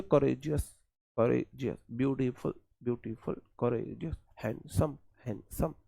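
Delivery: courageous, ब्यूटीफुल, हैंडसम each with a tiny inner gap; two-beat pronunciation. Shown as a demonstration. Teacher trying words out for students, courageous, ब्यूटीफुल, हैंडसम softly in two beats. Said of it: "हैंडसम बोल के देखो".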